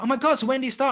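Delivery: Laura thrilled, asking when he starts; excited friend. Retold or imitated. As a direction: play Laura thrilled for him. Laura reacting to the news.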